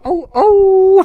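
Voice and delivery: high howling "oh, oh"; wolf howl. Pretend. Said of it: "and then I'll go".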